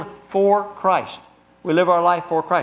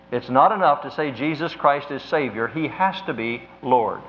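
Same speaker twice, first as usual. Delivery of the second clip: ordinary delivery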